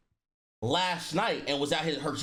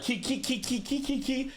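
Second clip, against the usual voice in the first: high, repeated mock giggle; sing-song high "kee-kee" mockery; imitated group giggling; 9 semitones higher than usual. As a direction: mimic a roomful of people tittering. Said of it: "they were all like".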